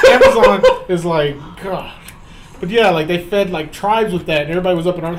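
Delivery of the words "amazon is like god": slow, loud, breaking into laughter; laughing. Real, not acted. Riffing on Amazon with friends, slow loud laughter. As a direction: barely get it out through the giggles. Laughing at own Bezos joke.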